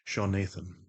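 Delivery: low, measured, even put-on voice; The Voice, composed and commanding. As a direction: keep it low and level, in unhurried control. Holds The Voice.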